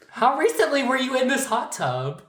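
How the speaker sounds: high-pitched, probing question; incredulous questioning. Real, not acted. Co-host questioning a friend about the hot tub, incredulous. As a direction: ask it high, in disbelief.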